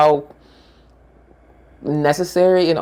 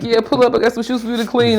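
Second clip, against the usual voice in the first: mock grandpa; 7 semitones higher, faster than usual. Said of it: "He was like".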